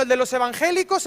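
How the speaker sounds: ordinary delivery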